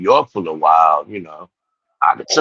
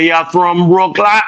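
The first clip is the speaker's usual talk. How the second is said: high, slow Jamaican question; mock Jamaican accent; 9 semitones higher, 4 dB louder, slower than usual. Asking the driver if he's from Brooklyn in Jamaican lilt. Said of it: "I said".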